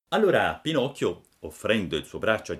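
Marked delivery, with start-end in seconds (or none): none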